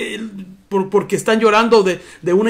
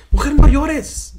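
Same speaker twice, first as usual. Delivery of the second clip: higher, louder, slow scolding; stern mock rebuke; 7 semitones higher, 7 dB louder, slower than usual. Preacher imitating a stern rebuke he says Jesús never gave. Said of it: "Jesús no le dijo".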